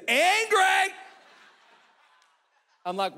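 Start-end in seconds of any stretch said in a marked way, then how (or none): 0.1-0.9 higher, louder, slow; understated shout about an angry wife; mock indignant shout. Real, not acted. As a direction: stretch it out with mock outrage. Describing Kate.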